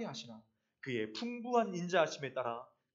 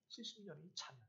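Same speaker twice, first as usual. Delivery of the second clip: hushed repeat; 14 dB quieter than usual. Shown as a demonstration. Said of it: "중요한 거 뭔지 아십니까".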